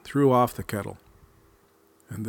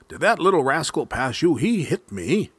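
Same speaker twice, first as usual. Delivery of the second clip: fast, loud, higher angry growl of a bear; 6 semitones higher, 6 dB louder than usual. Acted. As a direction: bear demanding answers, louder and quick. Angry bear chasing raccoon.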